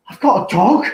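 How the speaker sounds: high, loud mock astonishment; amazed discovery. Retells groggy dental patient hearing news, high and amazed. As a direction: imitate someone thrilled by surprise news. Acting out man after anaesthetic.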